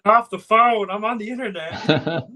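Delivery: high, loud mock yell; yelling teen on dial-up. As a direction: shout at the family to hang up. Dial-up family phone joke.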